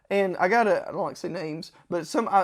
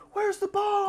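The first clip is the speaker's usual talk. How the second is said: high-pitched searching cry; mock frantic; 13 semitones higher, 4 dB quieter, slower than usual. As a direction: whine it, lost and urgent. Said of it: "we're in the embassy like".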